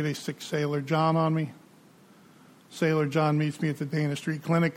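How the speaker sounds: ordinary talk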